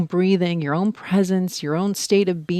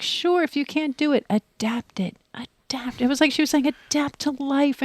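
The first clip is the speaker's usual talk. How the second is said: higher, soft encouraging teacher voice; 7 semitones higher, 4 dB quieter than usual. Retold or imitated. Teacher encouraging struggling students, higher and soft. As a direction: coax them gently to adapt. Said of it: "she's very encouraging".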